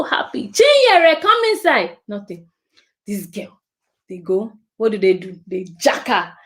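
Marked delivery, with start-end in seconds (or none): 0.5-2.0 high, loud call across the garden. Acted. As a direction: shout her name from the doorway. Adult calling child again.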